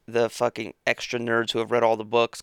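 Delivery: normal talking voice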